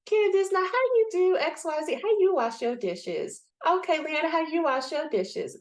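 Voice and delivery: high-pitched, put-on nosy asking; exaggerated high voice, imitating an advice seeker; mock pestering questioner. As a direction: go from friend to friend begging for tips. Acts out asking everybody for advice.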